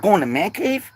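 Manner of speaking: ordinary talk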